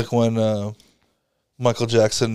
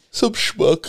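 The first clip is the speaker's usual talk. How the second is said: yawning; 9 semitones higher than usual. Real, not acted. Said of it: "You've gotta stop, my man".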